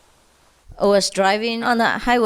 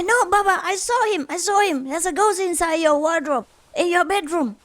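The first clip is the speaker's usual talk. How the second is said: put-on much higher, insistent little boy voice; insisting child; 8 semitones higher than usual. Five-year-old son insists to father about the ghost, high insistent child voice.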